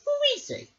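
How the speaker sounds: high questioning voice; curious Merrick. A narrator's reading. Merrick asking the king about the sorcerer. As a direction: ask it lightly, puzzled. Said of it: "said Merrick".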